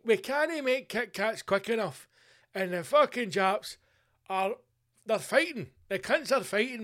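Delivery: loud, high boardroom rallying voice; fired-up executive. Acted. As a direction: address the lads with excited urgency. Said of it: "executives in a room going".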